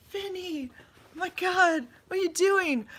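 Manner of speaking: high, slow, cooing scold; high pet voice. Real, not acted. Owner gently scolding her dog, high cooing voice. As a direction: coo at the dog in mock dismay. Dog doing something odd.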